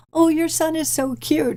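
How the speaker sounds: high, sugary gushing; gushing stranger. Retold or imitated. Strangers in the shoe store cooing at the child, high gush. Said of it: "people be like".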